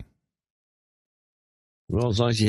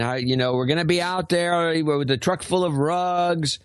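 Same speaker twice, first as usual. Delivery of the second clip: a light put-on Turkish accent, higher pitched and slowed; 5 semitones higher than usual. Mimicking the Turkish rug dealer who keeps calling, in a mild Turkish accent. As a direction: imitate the Turkish rug seller on the phone, a bit slow. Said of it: "it's always in this Turkish accent".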